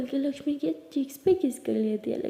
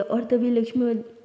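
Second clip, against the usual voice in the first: usual speaking voice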